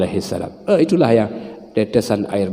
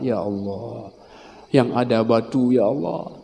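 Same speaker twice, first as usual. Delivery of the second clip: softer, choked imitation of a pilgrim crying; a hint of weeping; mild tearful prayer; 5 dB quieter than usual. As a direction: murmur it like a moved pilgrim.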